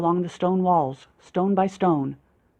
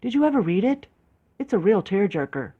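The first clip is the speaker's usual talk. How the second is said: excited; 2 semitones higher than usual. A narrator's reading. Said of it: "she exclaimed".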